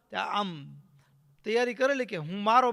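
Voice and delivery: ordinary talk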